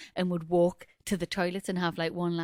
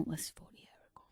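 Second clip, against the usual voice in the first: whispered aside; 4 semitones lower, 18 dB quieter, faster than usual. Real, not acted.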